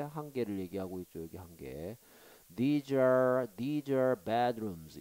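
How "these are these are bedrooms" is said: slow loud reading. Shown as a demonstration. Teacher reads with emphasis.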